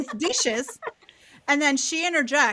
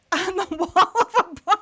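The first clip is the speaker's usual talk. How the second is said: higher and louder through laughter; laughing; 9 semitones higher, 5 dB louder than usual. Real, not acted. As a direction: finish the question giggling. Laughing at punchline.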